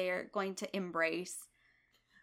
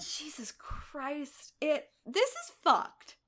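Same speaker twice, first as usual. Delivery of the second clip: exasperated outburst; 6 semitones higher than usual. Real, not acted. Exasperated outrage at episode.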